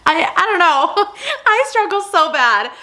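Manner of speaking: high laughing words. Real, not acted. Vlogger laughing at her dyslexia mix-up, giggling. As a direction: laugh through it at yourself.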